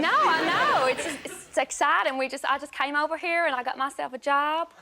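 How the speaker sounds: put-on a touch of American accent, chatty; light American accent, pleased with herself. New call centre worker chats to a caller, mild American accent. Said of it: "I wasn't expecting to speak to an American".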